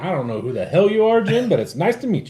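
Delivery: high, loud acted-out reply; mock greeting reply. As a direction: play an over-keen hello to a stranger. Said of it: "then we're like".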